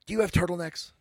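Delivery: loud, demanding customer barging in; storming customer. Retold or imitated. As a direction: bark the question at the clerk. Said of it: "This lady comes storming in".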